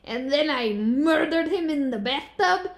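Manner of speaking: hammed-up Russian accent, slow and deliberate; mock Russian accent. Shown as a demonstration. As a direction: play a deadpan Russian killer reading her diary in a Russian accent. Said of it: "Can you do a Russian accent?".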